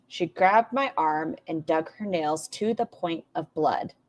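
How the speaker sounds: slow, measured recital of a quote; deliberate quoting. Retold or imitated. Reactor repeating the accusation to be heard, deliberate. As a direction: spell it out slowly so each part lands. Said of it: "Hear this sentence".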